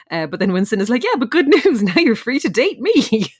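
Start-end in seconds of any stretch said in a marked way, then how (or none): 1.0-3.3 laughing mock offer. Winston quote, laughing.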